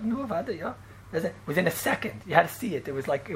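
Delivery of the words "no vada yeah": soft, higher, casual assent; offhand retold answer. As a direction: imitate a simple, understated reply. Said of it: "they would say it like that".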